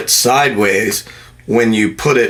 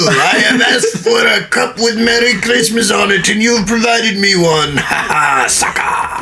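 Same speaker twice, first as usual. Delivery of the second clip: gloating voice; 10 semitones higher than usual. Pretend. Mocking gloating protester voice.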